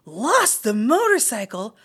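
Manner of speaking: high, loud dismayed cry; mother mouse hearing the motorcycle is lost; alarmed mother. A narrator's reading. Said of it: "cried his mother, genuinely alarmed".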